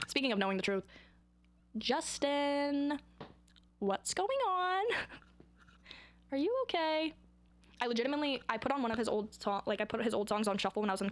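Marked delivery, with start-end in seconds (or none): 1.8-7.1 high, drawn-out pleading concern; hammed-up worried appeal; theatrical concern. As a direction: plead with an absent idol, high and slow. Addresses absent [name] with theatrical concern.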